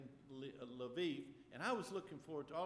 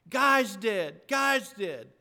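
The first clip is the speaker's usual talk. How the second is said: loud, high distraught cry; retold panicked cry; 6 semitones higher, 18 dB louder, much slower than usual. Daughter crying out her boyfriend's name to dad, loud. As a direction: blurt it out in panic. Daughter voiced screaming on phone.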